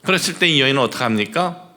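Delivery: usual speaking voice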